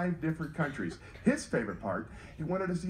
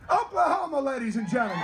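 announcer call-out; 7 semitones higher than usual. Real, not acted. Announcing audience member's home to crowd.

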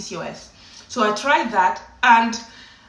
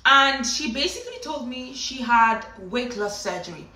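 normal talking voice

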